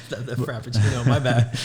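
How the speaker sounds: giggling over the slurp, loud; sheepish laughing apology. Real, not acted. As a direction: laugh through a quick sorry.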